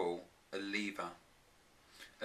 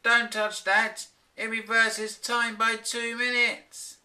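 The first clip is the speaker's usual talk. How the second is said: higher, louder, slow urgent shout; frantic warning; 13 semitones higher, 6 dB louder, slower than usual. A narrator's reading. Professor Clock warning his wife off the lever, frantic shout. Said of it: "rushing frantically".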